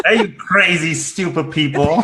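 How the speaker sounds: laughing while playing an insulting host; mock insulting greeting to viewers, laughing through it; mock rude greeting. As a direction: tease the audience with a grin, insults half-laughed. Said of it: "That won't help".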